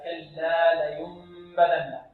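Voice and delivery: slow recited كلا لينبذن with an evenly weighted lam; careful, drawn-out كلا on a level note; measured lam. Shown as a demonstration. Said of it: "نعطي اللام ماذا قدرها من التوسط".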